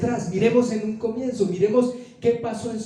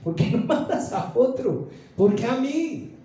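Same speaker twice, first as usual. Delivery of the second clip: imitated anxious questioning; mock fearful protest; 2 semitones higher than usual. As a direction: protest to God, rising in pitch. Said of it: "expresar al Señor aún tus temores".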